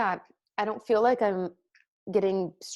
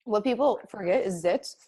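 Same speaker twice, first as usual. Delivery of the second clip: ordinary talk, nothing marked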